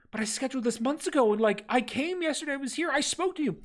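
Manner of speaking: high and fast, desperate protest; upset visitor. Acted. Visitor protests to the clerk, high and desperate. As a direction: plead fast, voice climbing. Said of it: "It's like".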